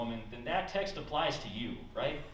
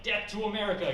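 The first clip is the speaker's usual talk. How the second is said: loud, high slogan cry; mock defiant shout; 9 semitones higher, 6 dB louder than usual. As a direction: shout it like a protest chant. Acted slogan at metal detector.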